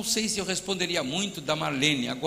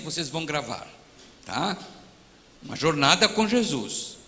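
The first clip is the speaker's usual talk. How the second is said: ordinary delivery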